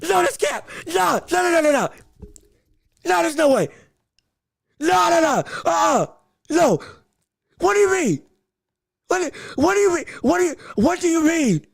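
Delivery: refusing to believe it; shouting disbelief at the screen; shocked denial. Real, not acted. Denies the shocking news.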